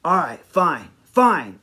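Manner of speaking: exasperated, grudging giving-in with attitude. Retold or imitated. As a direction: give in with attitude, each word falling. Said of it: "getting this attitude like".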